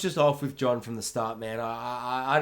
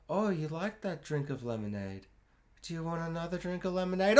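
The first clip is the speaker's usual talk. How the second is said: softer, higher sickly-sweet offer; creepy sweet offer; 3 semitones higher, 8 dB quieter than usual. Retold or imitated. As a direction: lure him in gently. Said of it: "he's like".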